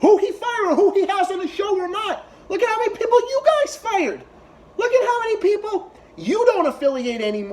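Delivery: high-pitched, strained protest; indignant high-pitched rant; exasperated outburst. Real, not acted. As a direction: shoot way up high in exasperated disbelief. Exasperated outburst at the show's hosts.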